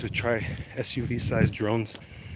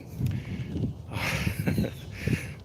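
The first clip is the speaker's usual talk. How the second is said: long amused chuckle; breath-filled laughing without voice; real laughing; 4 dB quieter than usual. Real, not acted. Laughs at crow bit.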